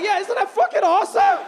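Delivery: high, loud proud yell; shouting with triumphant glee; excited. Retold or imitated. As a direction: brag about it at full volume. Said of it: "I'm like".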